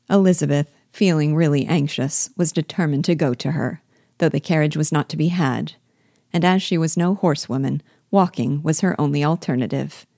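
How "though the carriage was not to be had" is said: ordinary delivery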